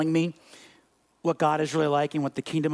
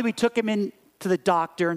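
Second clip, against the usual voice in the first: normal talking voice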